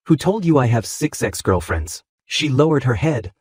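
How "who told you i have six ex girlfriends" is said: usual speaking voice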